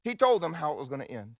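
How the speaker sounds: booming sermon build; preaching at full force. Acted. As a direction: proclaim it from the pulpit.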